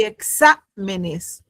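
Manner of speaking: "exámenes" slowed, split after a stressed "xá". Shown as a demonstration. Teacher comparing plural with singular, "exámenes" slowly with stress on "xá". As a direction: stretch and stress "xá" in "exámenes", then let the rest fall. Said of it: "fíjense el golpe de voz".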